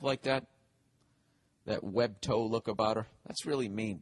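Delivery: ordinary talk, nothing marked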